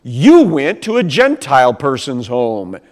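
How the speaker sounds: loud, accusing reproach; church accusing. Retold or imitated. Jerusalem church scolds Peter, loud and accusing. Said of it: "They give him some flack".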